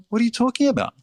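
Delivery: high-pitched imitation of a protesting worker; indignant immigrant worker. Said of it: "they would go".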